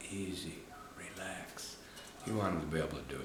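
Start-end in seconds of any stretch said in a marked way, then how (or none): 0.1-1.8 soft whisper to calm a dog; soothing whisper. Shown as a demonstration. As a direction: hush the dog down. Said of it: "when you say".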